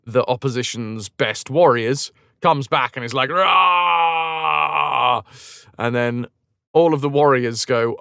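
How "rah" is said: long drawn-out roar; comic sustained war cry; battle roar. Acted. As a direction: roar like a raging warrior. Acting out battle rage return.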